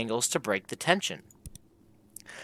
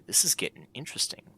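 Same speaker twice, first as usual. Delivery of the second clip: ordinary talk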